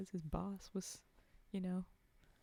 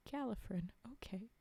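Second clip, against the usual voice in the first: plain reading voice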